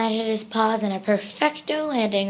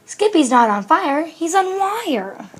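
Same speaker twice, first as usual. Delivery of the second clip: loud, high exclamation, last phrase drawn out; rising, stretched-out punchline; emphatic correction; 7 semitones higher, 6 dB louder, slower than usual. A narrator's reading. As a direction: set Mama straight excitedly. Sister corrects worried Mama.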